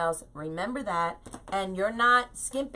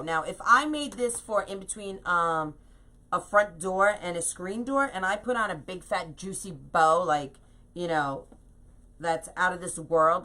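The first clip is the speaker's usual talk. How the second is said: ordinary delivery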